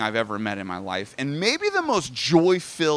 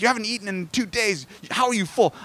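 high-pitched imitation of a disbelieving grandma; mock grandma scolding; exasperated grandma; 7 semitones higher than usual. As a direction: fuss at him like a worried wife. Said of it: "She's like".

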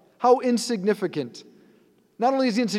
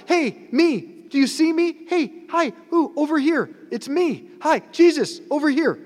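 put-on much higher, eager little-kid calling; like a child; 10 semitones higher than usual. Blind man calls to Jesus like a child, high and eager. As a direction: wave for attention like an excited child. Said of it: "Like a child".

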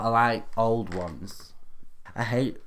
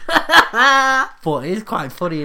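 slow, high, laughing through words; giggling tease; 12 semitones higher, 12 dB louder, slower than usual. Real, not acted. Teasing friend about nickname.

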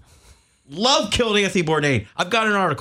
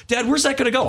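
put-on higher asking like a kid; child's voice; 9 semitones higher, faster than usual. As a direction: imitate a curious little boy. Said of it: "He goes".

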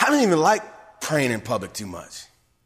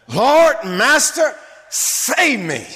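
loud, high cry of Lord, Master; desperate cry; 9 semitones higher, 6 dB louder, much slower than usual. Acted. Peter sinking, crying out to his Lord, loud and high. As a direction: cry out to be saved.

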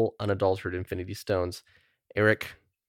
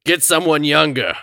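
slow, louder, raised gruff critter voice; Rocket Raccoon; 6 semitones higher, 6 dB louder than usual. Retold or imitated. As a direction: growl it out like a cocky raccoon. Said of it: "that was a great Rocket Raccoon impression".

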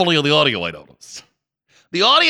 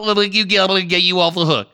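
ordinary delivery

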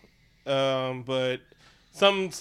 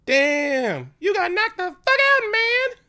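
very high, loud squeal of disbelief; mock high-pitched hype; hyped onlooker; 7 dB louder, much slower than usual. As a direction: shriek it like a stunned street bystander. Acted onlooker quote after fight.

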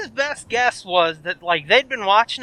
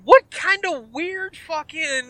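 slow disbelief; 7 semitones higher, slower than usual. Retold or imitated. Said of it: "And I'm like".

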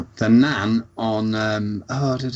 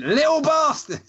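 put-on much higher, spat-out old lady curse; imitating Nan Peggy's insult. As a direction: snap it the moment the kids are gone. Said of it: "she'd come in".

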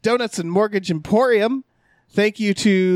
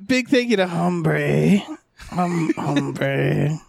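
low gravelly dry calm growl; grizzled voice; 3 semitones lower, much slower than usual. Acted. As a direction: rasp the name out slowly, grizzled. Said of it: "in a grizzled voice".

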